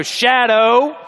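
a firm scolding call of the dog's name; stern call. Retold or imitated. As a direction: call her name like a disapproving owner. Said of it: "it's a stern voice".

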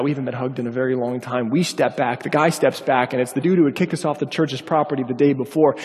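normal talking voice

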